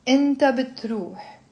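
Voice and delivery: pronoun and verb run together. Shown as a demonstration. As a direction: say it briskly in one go.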